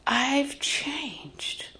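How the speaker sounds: soft, half-whispered declaration; breath-heavy, quiet emphasis; hushed testimony. Real, not acted. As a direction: let it out in an awed hush. Preacher dwells on her testimony.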